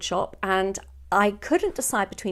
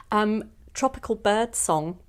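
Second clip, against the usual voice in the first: normal talking voice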